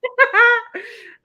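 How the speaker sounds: loud, high burst of laughter. Real, not acted. Friend laughing at the highlight-of-the-year line, loud laugh.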